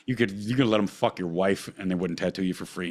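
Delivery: ordinary delivery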